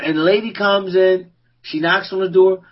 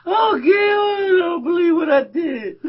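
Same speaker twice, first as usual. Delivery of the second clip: high, wailing, half-crying mock lament; mom's sobbing phone call, imitated high and wailing; imitating bawling; 10 semitones higher than usual. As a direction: wail it like a distraught woman on the phone. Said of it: "she's just bawling, crying".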